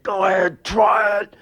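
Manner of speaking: high shouted dare; threatening shout. Retold or imitated. Son holding a knife on his mother, shouted threat.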